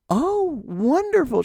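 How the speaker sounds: gasp, then a very high cooing 'oh, wonderful'; mock delight. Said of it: "everyone's giving her the".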